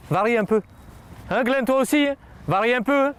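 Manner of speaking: high, projected instruction to a player; coaching call-out. Real, not acted. (consigne d'entraîneur lancée aux joueurs)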